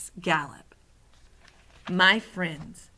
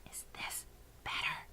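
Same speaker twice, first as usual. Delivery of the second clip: under the breath; 16 dB quieter than usual. A narrator's reading. Sophie tries speaking more softly, under her breath. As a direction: breathe the words without any voice. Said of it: "speaking right under her breath".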